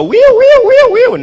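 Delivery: very high, loud wee wee siren; car alarm imitation. Comic mocking an ordinary car alarm siren.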